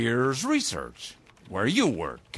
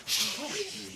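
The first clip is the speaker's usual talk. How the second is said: sharp, soft shush; quiet him down fast; hushing; 12 semitones higher, 6 dB quieter than usual. Acted. Biddle hushing James before the broadcast, sharp shush.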